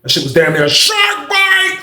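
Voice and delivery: high, slow mock awe; joking exaggeration. Telling friends a tall tale, high, slow, mock-awed. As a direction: ham it up as a joke. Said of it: "No, I'm joking".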